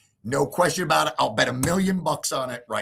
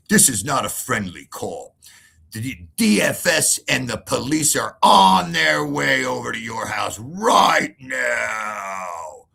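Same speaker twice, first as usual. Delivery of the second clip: louder, slow menacing warning; threatening; 5 dB louder, slower than usual. Retold or imitated. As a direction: threaten him down the phone. Father's threatening call retold.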